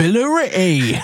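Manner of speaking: put-on the town name in a mild Essex accent. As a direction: say Billericay with a light Essex twang. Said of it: "the modern interpretation of an Essex accent".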